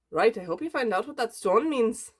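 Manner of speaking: hammed-up Irish brogue; bad Irish accent. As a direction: put on a terrible Irish accent. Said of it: "such a bad Irish accent".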